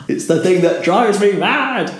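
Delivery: "mad" sent soaring up at the end; mock rising intonation. Shown as a demonstration. Broadcaster ranting about wrong intonation, exasperated mock uptalk. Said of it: "You don't go up".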